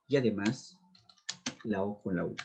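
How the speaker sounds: normal talking voice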